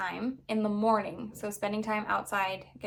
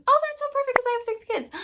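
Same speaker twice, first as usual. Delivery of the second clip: much higher, fast, bubbly; delighted realization; 4 dB louder, faster than usual. Real, not acted. Mom noticing six things match six kids, high excited squeal.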